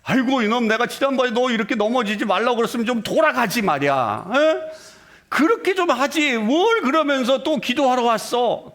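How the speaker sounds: a hammed-up scolding father. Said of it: "야단치지 않으시고". (자녀를 야단치는 흉내)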